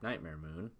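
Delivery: plain reading voice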